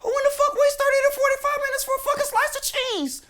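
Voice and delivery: can't believe what you heard; squeaky outrage at waiting for cheese; incredulous. Real, not acted. Disbelief at news story.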